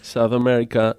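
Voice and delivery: ordinary talk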